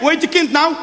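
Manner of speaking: normal talking voice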